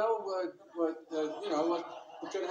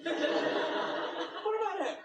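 slow, stretched, higher question; drawn out; 12 semitones higher, much slower than usual. Real, not acted. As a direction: drag each word out teasingly. Asks about father, drawn out.

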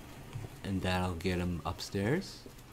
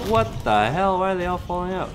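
louder, higher baffled cry; loud surprised shout; 9 semitones higher, 7 dB louder, faster than usual. Real, not acted. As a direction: shout it in disbelief. Zombies falling out.

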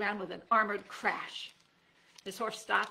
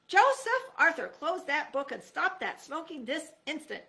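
high, loud scolding mother; a mother snapping an order across the kitchen; stern mom calling; 7 semitones higher, 7 dB louder than usual. A narrator's reading. Mom scolds the boys from the kitchen, loud and stern.

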